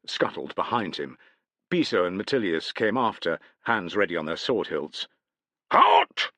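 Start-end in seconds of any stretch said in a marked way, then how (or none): 5.7-6.3 much higher, loud sentry bellow; guards' loud halt. A narrator's reading. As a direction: bark the challenge at full volume. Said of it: "both sentries bellowed".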